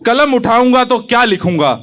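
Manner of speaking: measured, declaimed patriotic verse; poem recitation. Acted. Young poet opens his poem to the audience, declaimed. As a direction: recite it to a hall, line by line.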